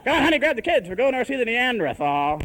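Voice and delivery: high, loud parent rounding everyone up; calling the family. Acted. Father rounds up honey and kids, calling out loudly. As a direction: call out to round up the household.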